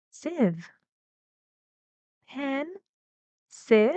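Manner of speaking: "Pen", then "Sieve" pushed up further; teacher isolating the rise in "Pen", "Sieve"; rising syllables. Shown as a demonstration. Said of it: "it even goes higher".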